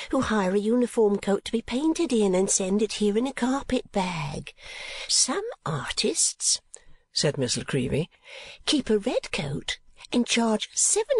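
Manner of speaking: high-voiced chattering portrait painter; Miss La Creevy. A narrator's reading. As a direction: lift into a high, prim, gossipy voice.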